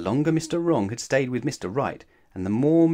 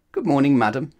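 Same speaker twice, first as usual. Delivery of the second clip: louder, bright good morning; cheery greeting; 4 semitones higher, 7 dB louder than usual. Acted. Mister Wrong greets loudly.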